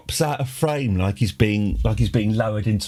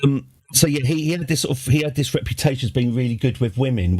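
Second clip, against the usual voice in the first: usual speaking voice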